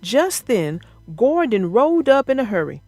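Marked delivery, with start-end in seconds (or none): none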